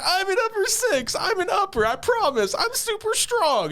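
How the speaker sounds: very high, mock-crying child; crying kid fit. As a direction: imitate a child wailing through a tantrum. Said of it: "she starts crying and".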